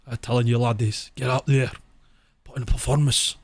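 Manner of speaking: put-on lightly done Scottish gaffer accent; Sir Alex Ferguson Scottish accent. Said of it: "translator with Sir Alex's accent".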